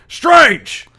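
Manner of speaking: sudden high, very loud bellow; shouting police captain. Acted. Captain summons his detective, furious shout. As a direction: bellow the surname like an irate boss. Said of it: "Jackson comes up to you and goes".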